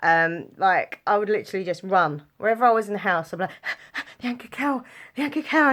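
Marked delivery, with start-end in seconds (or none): 3.5-4.1 quick mock gasp; excited gasp. Child hearing the advert from upstairs, mock excited gasp. 4.2-5.7 high, breathless excitement; excited child. Retold or imitated. Child running to join the TV advert, mock breathless excitement.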